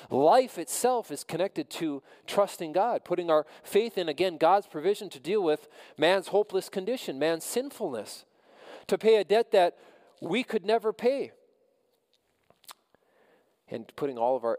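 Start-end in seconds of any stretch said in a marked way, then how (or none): 0.1-11.3 fervent preaching. Acted. Pastor urging listeners toward faith, fervent preaching. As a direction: lift the voice and press the message.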